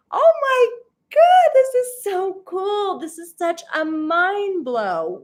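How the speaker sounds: real thrill at viewers from everywhere; thrilled squeal. Real, not acted. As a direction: gush with real excitement.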